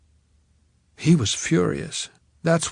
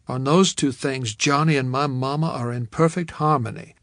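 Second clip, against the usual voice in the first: ordinary delivery, nothing marked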